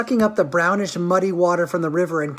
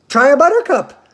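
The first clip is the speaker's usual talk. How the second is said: much higher and louder, coaxing; eager Mr Wonka; 8 semitones higher, 7 dB louder than usual. A narrator's reading. Wonka urging the children to taste, eager and high. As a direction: tempt them with a buttercup.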